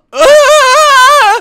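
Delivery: high, loud frightened cry; scared scream. Acted. As the door slams by itself, scared scream. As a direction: jump at the slam.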